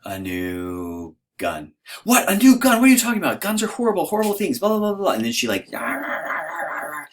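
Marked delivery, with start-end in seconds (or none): 0.0-1.7 halting, drawn-out admission; slow, sheepish husband owning up; hesitant confession. Acted. Acts out confessing a purchase to wife. 2.0-5.1 high, loud, hammed-up outburst; shrieky mock scolding at high pitch; mock outraged wife. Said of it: "So she's mad". 5.7-7.0 hammed-up yammering of a mad wife; mock angry ranting. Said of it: "So she's mad".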